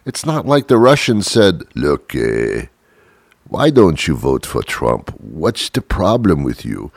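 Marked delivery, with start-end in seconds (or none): 1.8-6.9 low, heavy mock Russian voice; imitating a Russian. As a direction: lean in like a pushy Russian agent. Russians voiced as pushy persuaders.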